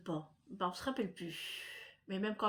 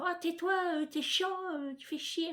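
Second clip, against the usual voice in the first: rude neighbour; 9 semitones higher than usual. Retold or imitated. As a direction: snap at the barking dog like an annoyed passer-by. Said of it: "ils vont dire".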